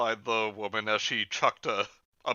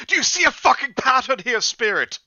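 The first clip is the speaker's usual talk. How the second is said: much louder, higher furious outburst; exasperated yell; 12 semitones higher, 9 dB louder than usual. Acted. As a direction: blow up at the Spirit. Exasperated rant at spirit.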